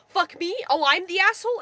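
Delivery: imitating his outraged disbelief; indignant retort. Passenger throwing the insult back at driver, indignant.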